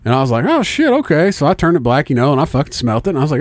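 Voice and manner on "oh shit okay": pitched up high, suddenly getting it; a sudden high oh of discovery; surprised realization. Retold or imitated.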